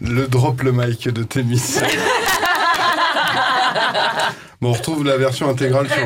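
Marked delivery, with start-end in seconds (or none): 1.7-4.3 squealing laugh; high burst of laughter. Real, not acted.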